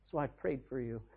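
quiet amused reply; gently laughing Jesus. Retold or imitated. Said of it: "You know what Jesus said".

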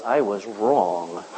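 slow, low, dismayed confession; understated dismay; rueful admission. Retold or imitated. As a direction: let the truth sink in slowly. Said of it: "wakes up in hell and goes".

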